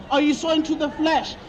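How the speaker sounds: ordinary talk, nothing marked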